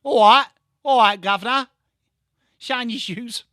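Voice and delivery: loud put-on Cockney patter; Cockney accent. Showing chat London speech, loud Cockney street greeting. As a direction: hail the customer like a Cockney. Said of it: "Cockney, somewhat, it, it's London".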